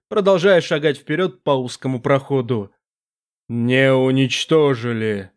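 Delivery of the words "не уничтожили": low, slow, echoing demon voice; a vast presence speaking from the dark; booming demon voice. Acted. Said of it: "настаивал Бас".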